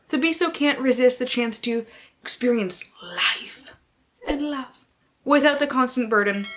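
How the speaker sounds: theatrical synopsis with hushed dips; dramatic reading. Acted. Booktuber dramatically reading the Princess in Theory blurb, theatrical. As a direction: ham up the blurb like a trailer. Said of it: "I will be doing dramatic readings".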